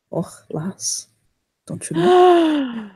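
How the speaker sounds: slow, playful Irish brogue; drawn-out Irish brogue teasing the ladies; Irish accent. Acted. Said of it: "She has a thing for accents".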